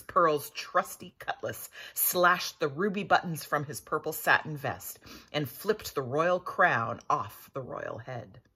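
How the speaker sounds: ordinary delivery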